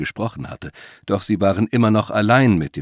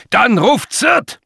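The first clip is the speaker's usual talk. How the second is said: high, loud, drawn-out yell; angry scolding; 8 dB louder, much slower than usual. A narrator's reading. Old man cutting off the voice, scolding loudly. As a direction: cut in with an irate order. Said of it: "schimpfte der Alte".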